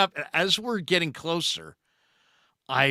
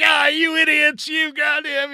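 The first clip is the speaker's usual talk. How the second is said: much higher and louder furious mock yell; angry swearing; 13 semitones higher, 6 dB louder than usual. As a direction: imitate an old pitcher cursing the kids out. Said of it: "They're swearing".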